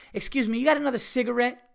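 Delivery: high, pushy demand for a cigarette; abrupt rude stranger. Retold or imitated. Stranger on the street abruptly demands a cigarette, high and pushy. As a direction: bark the question like you're owed it. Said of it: "very abruptly, with a lot of energy and no fucking manners".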